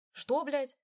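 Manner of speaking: выше и громче, в ярости; брось ругательство в бешенстве; яростное шипение. A narrator's reading. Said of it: "прошипел Викенза". (Викенза в ярости переспрашивает брата)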